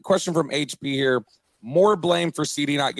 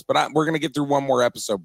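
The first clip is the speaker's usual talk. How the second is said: ordinary talk, nothing marked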